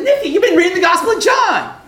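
high, loud exclamation; excited realisation. Retold or imitated. Preacher realising what professor read, excited shout. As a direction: cry out the discovery.

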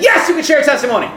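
high, loud, emphatic consent; exultant yes. Retold or imitated. Preacher granting testimony request, exultant shout. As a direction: shout back in delight.

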